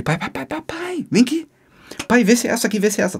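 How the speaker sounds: put-on high, excited kid calling dad; empolgado, feliz da vida. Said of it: "Eu todo feliz da vida". (chamando o pai todo empolgado)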